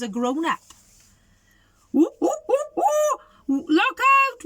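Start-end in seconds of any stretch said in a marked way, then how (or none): 1.9-4.4 high, loud ape whooping; chimpanzee whoops. Acted. Chimpanzee warns the others, whooping loudly. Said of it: "whooped the chimpanzee".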